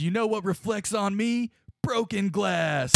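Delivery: defiant showboating. Acted. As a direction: announce it from a tabletop, slowing for the punchline. Scotch grandstands on table, pointing.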